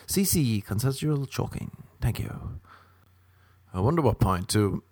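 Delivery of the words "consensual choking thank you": "consensual" dicho bajito; soft aside. Shown as a demonstration. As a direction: suelta "consensual choking" en voz baja, casi susurrando.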